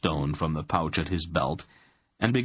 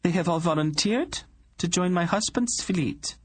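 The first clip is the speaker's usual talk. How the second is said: much higher, composed royal woman; cool queen voice; 13 semitones higher than usual. Acted. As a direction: speak down to him as a calm queen. Queen voice continues.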